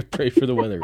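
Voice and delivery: fast, laughing repeat. Real, not acted. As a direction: laugh the words out. Echoes guest's joke, laughing.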